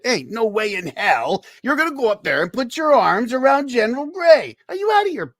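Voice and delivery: high, loud disbelief; can't believe what he hears; mock shocked friend; incredulous buddy. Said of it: "he said".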